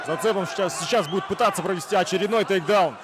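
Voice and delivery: ordinary delivery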